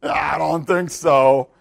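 slow, drawn-out skeptical aside; inward doubting voice. Acted. Believers inwardly doubting they could move a tree, skeptical. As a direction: drag it out in exaggerated doubt. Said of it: "But inwardly we're saying what?".

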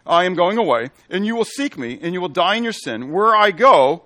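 loud, ringing scripture reading; preaching scripture. Acted. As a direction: proclaim it to the congregation. Preacher reading Jesus' words.

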